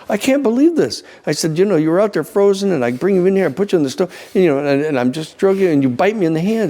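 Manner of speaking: hammed-up old lady scolding the snake; mock old lady.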